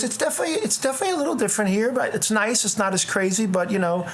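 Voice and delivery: imitating the hygienist. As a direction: pitch up and rattle it off breezily.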